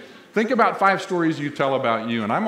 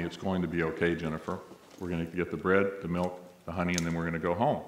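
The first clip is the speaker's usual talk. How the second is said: soft, low and soothing, much quieter; very calmly; 7 semitones lower, 8 dB quieter than usual. Retold or imitated. Mother soothing her screaming child in a deli, very calm and quiet. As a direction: lower and softer, steady and unhurried to calm a child. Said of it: "the woman say very calmly".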